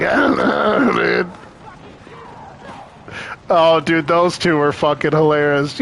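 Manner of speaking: usual speaking voice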